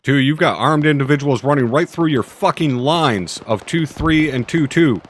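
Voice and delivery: loud, high, urgent radio warning; urgent shouted callout. Real, not acted. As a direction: bark the contact report over gunfire, slowing at the end. Urgent contact report under fire.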